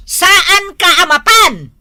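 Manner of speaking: slow, loud, stern repeat; angry scolding. Acted. Wife forbidding husband to go drinking, slow stern shout. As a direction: hammer the question home.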